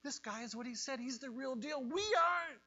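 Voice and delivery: urgent rebuke. Acted. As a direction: rebuke him, rising to a cry. One thief rebukes the other.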